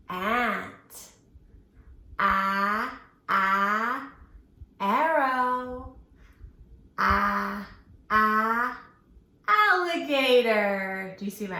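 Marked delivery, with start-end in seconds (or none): 2.2-4.1 "Ah" as a short open a sound, stretched; letter A sound. Shown as a demonstration. Teacher sounds out the letter A for the kids, stretched "ah". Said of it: "put our letter A sound at the beginning". 7.0-11.1 "Ah, ah, alligator" with a louder slow stretched "alligator"; sounding out. Shown as a demonstration. Teacher sounds out alligator for the kids, slow and louder. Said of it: "put our letter A sound at the beginning".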